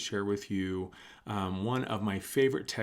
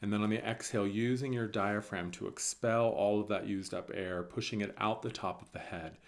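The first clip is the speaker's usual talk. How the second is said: soft, calm meditation voice with long pauses; guided meditation; 6 dB quieter than usual. Real, not acted. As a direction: guide them gently through each breath, softly. Leads a breathing meditation.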